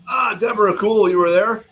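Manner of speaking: light up as a friend shows up in chat; happy surprise at a viewer being there; excited greeting. Real, not acted. Greeting a viewer who attended.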